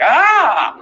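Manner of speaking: sharp high yelp of pain from a needle jab; loud, high mock ouch. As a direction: yelp as if a needle jabs you. Stranger poking with needle.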